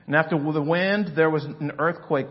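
loud, building preacher reading of scripture; sermon reading with rising force; preaching. Acted. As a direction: proclaim the storm over the crowd.

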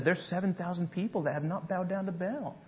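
God gently calling Elijah back. Acted. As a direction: speak close, urging him gently. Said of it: "He says".

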